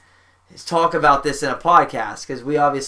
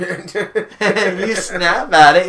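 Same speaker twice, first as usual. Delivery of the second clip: laughing, louder and higher commentary; mock play-by-play; 4 semitones higher, 5 dB louder, much slower than usual. Podcaster narrating the cat incident to co-hosts, mock play-by-play. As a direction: call it slowly like a live commentator. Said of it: "running some play-by-play right there".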